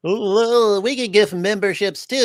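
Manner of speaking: high, silly put-on fan voice; mocking fan voice. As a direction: mimic overeager copycat fans, ending in goofy laughter. Mocking the rival fans.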